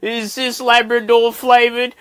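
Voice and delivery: high, loud, drawn-out mock wail; hammed-up tearful question, slow and high-pitched; mock crying. Upset eater asks mate about the biscuits, mock crying. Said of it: "I'm crying at this point".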